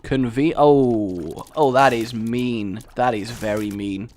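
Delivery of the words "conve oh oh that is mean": ordinary talk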